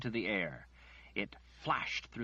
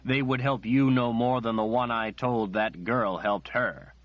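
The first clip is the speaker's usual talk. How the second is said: bitter outburst; 9 dB louder than usual. Acted. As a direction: let the anger sink into grief.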